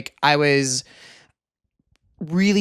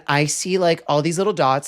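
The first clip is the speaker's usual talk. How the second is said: normal talking voice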